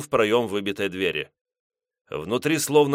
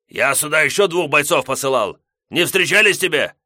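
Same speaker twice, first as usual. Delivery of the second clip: shouting; 6 semitones higher, 6 dB louder than usual. Acted. Gos shouting.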